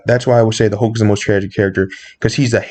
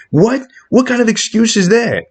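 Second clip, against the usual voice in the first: high, incredulous outburst; indignant disbelief; 11 semitones higher than usual. Real, not acted. Fan rejecting Hawkeye's excuse, indignant disbelief. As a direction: throw the question out in high outrage.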